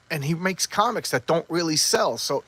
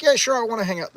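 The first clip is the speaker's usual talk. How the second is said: higher, louder mock-keen reply; eager acceptance; 8 semitones higher, 5 dB louder, faster than usual. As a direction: imitate someone jumping at an invitation. Acting out eager acceptance of invitation.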